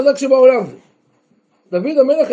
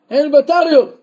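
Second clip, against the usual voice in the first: ordinary talk, nothing marked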